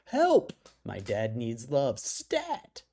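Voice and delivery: hammed-up emergency plea; mock desperate. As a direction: read it like a frantic distress alert. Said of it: "each subject line more desperate than the last".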